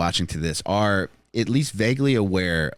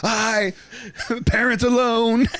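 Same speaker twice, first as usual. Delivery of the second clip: high mock voice; 13 semitones higher, slower than usual.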